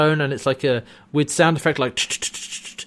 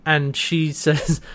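ordinary delivery

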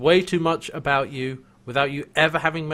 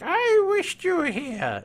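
high, slow, creaky elder; old man voice; 13 semitones higher, slower than usual. Retold or imitated. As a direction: play a frail old wizard. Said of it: "in his best old man voice".

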